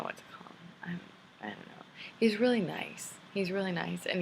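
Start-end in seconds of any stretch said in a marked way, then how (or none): 0.0-1.9 whisper. Real, not acted. Confiding about the guy, hushed.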